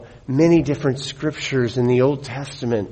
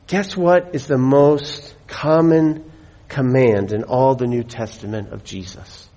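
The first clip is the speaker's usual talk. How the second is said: normal talking voice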